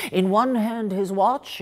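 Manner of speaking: usual speaking voice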